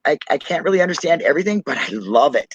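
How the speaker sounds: usual speaking voice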